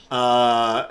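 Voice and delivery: ordinary talk, nothing marked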